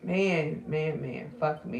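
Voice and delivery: normal talking voice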